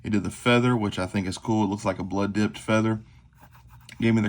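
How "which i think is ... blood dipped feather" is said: ordinary talk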